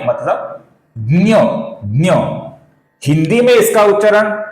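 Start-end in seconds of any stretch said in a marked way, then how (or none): 1.0-2.1 ज्ञ said as "dnya"; Marathi pronunciation. Shown as a demonstration. Teacher showing how Marathi says the letter, ज्ञ as "dnya". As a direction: say ज्ञ with d, n and y blended. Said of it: "इसका उच्चारण मराठी में".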